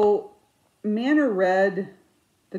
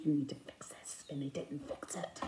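hushed, breathy aside; whisper; 3 semitones lower, 13 dB quieter than usual. Real, not acted. Vlogger whispering to viewers about the framer. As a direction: whisper it like a shared grievance. Ranting about the unfixed framing.

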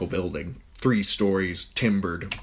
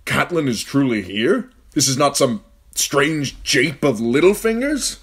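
disbelieving; 8 semitones higher, 7 dB louder than usual. A narrator's reading. Ned lost and disbelieving.

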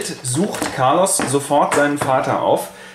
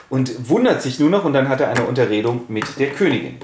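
usual speaking voice

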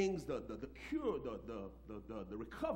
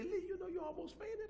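lightly voiced nurse, much higher; a hint of a gentle nurse; voicing the nurse. Pastor retelling the nurse's warning, lightly voiced nurse. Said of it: "she said".

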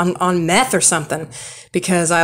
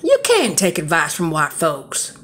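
quoting the man. Retold or imitated. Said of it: "And he goes".

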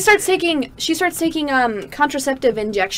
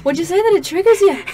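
put-on Irish accent; 5 semitones higher, 4 dB louder, faster than usual. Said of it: "an Irish accent".

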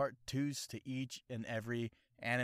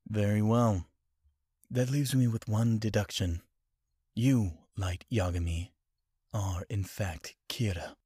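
detective deduction voice; 8 dB louder than usual. Retold or imitated. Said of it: "anime impression video".

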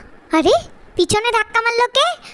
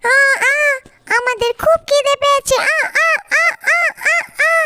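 put-on whining baby mice; 4 semitones higher than usual. Hungry babies whining.

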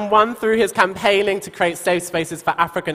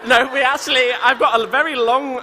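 laughing; 6 semitones higher than usual. Real, not acted. Laughing after joke.

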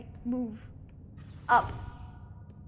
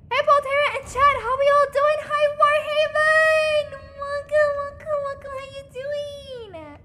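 excited greeting; 10 semitones higher, 6 dB louder than usual. Real, not acted. Streamer welcoming newcomers to chat, squealing with excitement. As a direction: squeal hello in a much higher voice, stretching the ends.

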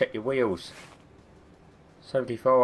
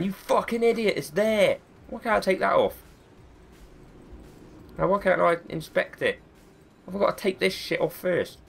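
mock viewer yelling; 9 semitones higher than usual. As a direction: play the frustrated viewer shouting at him. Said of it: "just screaming at the screen".

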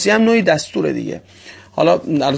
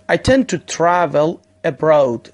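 very slow, stress on tend; slow example sentence; much slower than usual. Shown as a demonstration. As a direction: read the example slowly for learners. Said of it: "یک مثال هم زدیم". (جمله نمونه، آهسته و شمرده)

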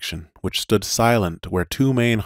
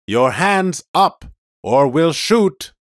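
high, slow, loud warning; shouted threat; 9 semitones higher, 5 dB louder, slower than usual. Acted. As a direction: threaten to shoot. Police car threatens.

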